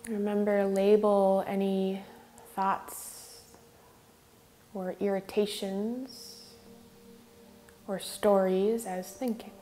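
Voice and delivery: measured meditation lead with long pauses; guided meditation. Real, not acted. Yoga teacher leading students in regulating the breath, guided meditation. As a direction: guide them unhurriedly, leaving space between phrases.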